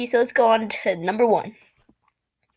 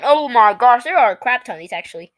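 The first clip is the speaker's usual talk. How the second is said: ordinary delivery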